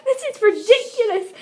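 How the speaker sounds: reading through real laughter; can't keep a straight face, laugh through it. Real, not acted. Reader cracks up reading aloud.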